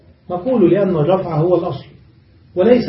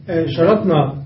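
normal talking voice

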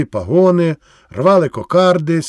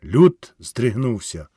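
plain reading voice

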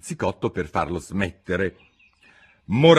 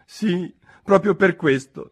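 sighing; 11 semitones higher, 5 dB louder than usual. Acted. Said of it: "con un sospiro".

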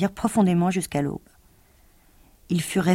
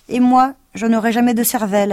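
higher, louder complaint; scarecrow lament; 5 semitones higher, 6 dB louder than usual. Acted. Scarecrow lamenting to his friends, plaintive. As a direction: moan about having no brains.

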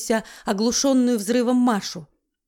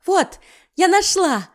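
high, loud, slow happy exclaim; joyful cry; 8 semitones higher, 5 dB louder, slower than usual. Acted. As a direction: cry out in delight, drawn out. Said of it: "радостно вскрикнула Маша".

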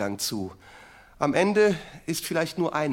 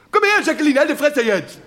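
put-on yelling at the kids, laughing; rough shout; 10 semitones higher, 8 dB louder than usual. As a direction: bark it out high and loud at Jacqueline. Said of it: "Ja, so". (laut brüllend, halb lachend)

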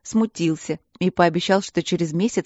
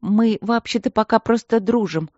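put-on higher, girlish huffy reply; offended; 5 semitones higher, 3 dB louder than usual. A narrator's reading. Princess defending her friendship, offended. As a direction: answer hurt on his behalf. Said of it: "обидевшись за Матвея".

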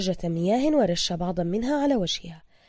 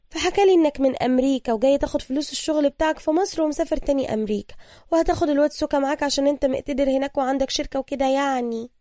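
chatty, eager woman; 6 semitones higher, 5 dB louder than usual. A narrator's reading. Woman explaining to the hero what she was told, chatty. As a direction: rattle on as the eager local woman. Narrator voices Ibtisam.

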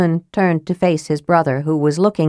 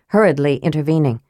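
ordinary delivery, nothing marked

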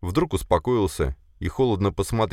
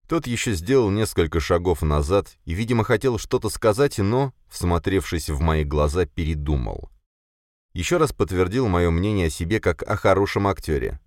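ordinary delivery, nothing marked

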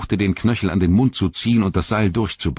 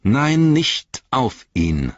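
raised, high first word, then slower; sharp refusal; 7 semitones higher, 5 dB louder, much slower than usual. A narrator's reading. Frodo forbidding Gollum to swear on the Ring, sharp. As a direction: cut in firmly, stressing the refusal.

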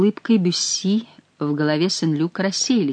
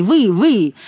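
calling out; 7 semitones higher than usual. A narrator's reading. Stranger still shouting after them.

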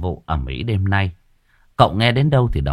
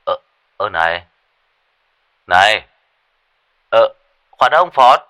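flustered calling out; 6 dB louder than usual. A narrator's reading. Master calling after hung-up phone.

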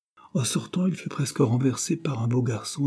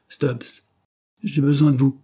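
raised, louder summons; calling out; 5 semitones higher, 6 dB louder than usual. A narrator's reading. Dare calling Stubbs through the half-open door, calling out. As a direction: call an employee over with higher pitch. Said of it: "appela monsieur Dare".

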